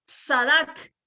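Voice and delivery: "Sadak" with a clear ts at the start, rising; careful pronunciation. Shown as a demonstration. Pastor warning listeners the recording may drop the ts sound. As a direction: spell out "Sadak" so the t and s are both heard. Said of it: "lo estoy pronunciando con una T y una S".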